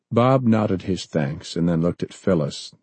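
ordinary delivery

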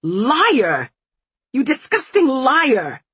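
loud, high-pitched accusation; explosive, enraged denunciation; furious shout. A narrator's reading. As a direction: scream it in outrage. Accused daughter lashes out in rage.

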